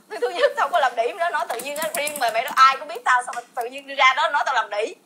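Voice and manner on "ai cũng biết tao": normal talking voice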